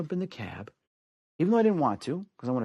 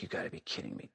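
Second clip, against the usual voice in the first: whispered disbelief; 14 dB quieter, faster than usual. Retold or imitated. Hearing mom may die.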